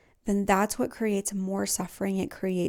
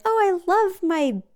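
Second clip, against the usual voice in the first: bright, gushing fake cheer, pitched higher; mock toxic positivity; 9 semitones higher, 4 dB louder than usual. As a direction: parody forced self-love. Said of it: "don't jump to a toxic positivity".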